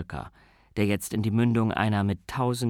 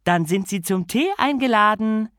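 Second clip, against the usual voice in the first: higher and louder, cheerful invitation; cheerful call; 9 semitones higher, 5 dB louder than usual. Acted. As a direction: call out gaily like a delighted hostess. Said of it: "rief sie fröhlich".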